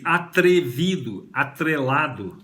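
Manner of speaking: atrevido, atrelado drawn out in syllables; slow articulation. Shown as a demonstration. Teacher linking atrevido, atrelado to three, slowly articulated. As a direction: stretch atrevido, atrelado, sounding the TRE. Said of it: "você tá articulando, pronunciando".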